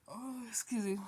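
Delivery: finishing a yawn. Real, not acted. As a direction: apologize mid-yawn. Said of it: "I don't know why I keep yawning".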